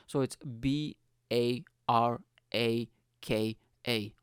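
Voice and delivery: spelling out each letter slowly; spelled out. Shown as a demonstration. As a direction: read out the letters one at a time. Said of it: "spelling it out".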